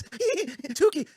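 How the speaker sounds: high, laughing mock Rob Saul voice; giggling impression of a streamer friend. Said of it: "Rob Saul voice".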